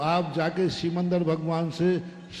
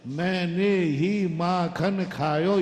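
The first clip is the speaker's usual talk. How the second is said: each word drawn out slowly; slow and deliberate; much slower than usual. Shown as a demonstration. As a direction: spell it out slowly to the mother. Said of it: "तो सुनो". (धीरे-धीरे, हर शब्द खींचकर)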